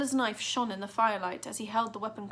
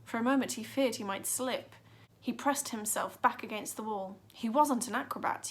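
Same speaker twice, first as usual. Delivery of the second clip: plain reading voice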